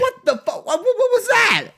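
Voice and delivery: high, squealing disbelief; real stunned stammer; shocked. Real, not acted. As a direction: burst out baffled, pitch shooting up. Shocked at the bad take.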